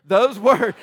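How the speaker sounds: Those words said loud through a laugh; amused loud redo; laughing retake. Real, not acted. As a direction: laugh at yourself as you repeat it. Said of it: "that was very enthusiastic".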